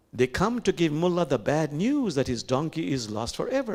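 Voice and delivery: ordinary talk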